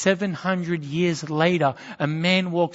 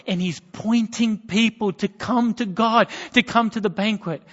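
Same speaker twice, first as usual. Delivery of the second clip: preaching; 5 semitones higher, 4 dB louder than usual. Acted. Preaching build.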